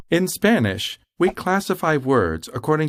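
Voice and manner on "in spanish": ordinary talk, nothing marked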